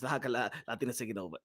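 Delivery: low, soft and fast; hushed quick statement. Shown as a demonstration. Host confiding to the audience about Latinos, hushed quick statement. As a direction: drop low and rush it under your breath. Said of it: "I'm gonna say it like this".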